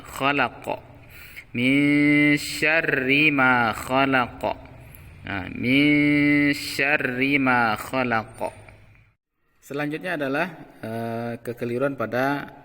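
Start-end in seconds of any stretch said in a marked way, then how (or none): 0.0-8.5 khalaqa with the qaf bounced; slow recitation, khalaqa with echoing qaf. Shown as a demonstration. Teacher reciting slowly so the qaf bounces. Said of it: "harus dipantulkan".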